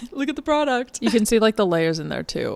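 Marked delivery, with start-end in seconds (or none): 0.1-1.0 put-on old YouTuber voice. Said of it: "one of those old YouTubers".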